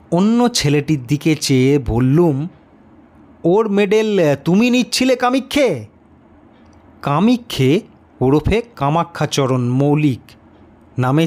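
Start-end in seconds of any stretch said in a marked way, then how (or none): 3.4-5.8 teacher questioning. A narrator's reading.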